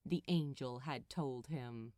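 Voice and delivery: plain reading voice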